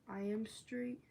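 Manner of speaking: usual speaking voice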